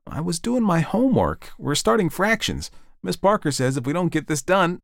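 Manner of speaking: put-on high-pitched boy's voice; protesting Tommy. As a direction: explain as a schoolboy called away.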